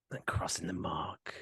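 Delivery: hushed unvoiced whisper; whispering for no reason. Real, not acted. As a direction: drop to a breathy secret whisper. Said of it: "I don't know why I'm talking like this".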